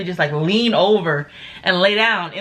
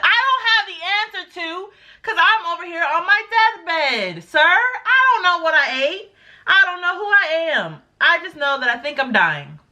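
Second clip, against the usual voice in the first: exasperated; 7 semitones higher than usual. Retold or imitated. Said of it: "deathbed complaint to the EMT".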